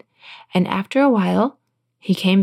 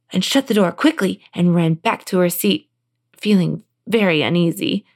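plain reading voice

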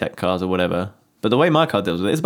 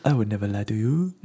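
robot spider lure; 5 dB quieter than usual. Pretend.

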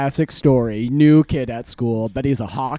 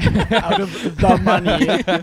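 laughing; 5 semitones higher, slower than usual. Real, not acted. Friend mockingly repeats, laughing.

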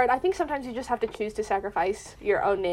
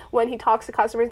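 normal talking voice

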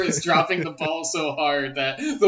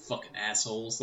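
put-on Chicago accent; 7 semitones lower, 11 dB quieter than usual. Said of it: "a deep Chicago accent".

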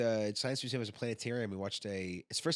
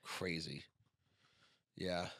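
normal talking voice

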